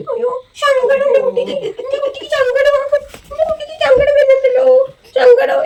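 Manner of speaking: high cooing mock sympathy, half laughing. Real, not acted. Grandmother cooing to the fussing baby, high and half laughing.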